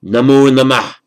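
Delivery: Namo Namah called out high and loud; loud greeting. Shown as a demonstration. Teacher shows students the greeting Namo Namah, loud. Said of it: "or say it twice".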